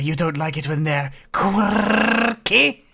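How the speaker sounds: slow, teasing, trilled R in quirky; rolled r. Acted. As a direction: roll the R in quirky mockingly. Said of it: "What's the matter, Shibuya".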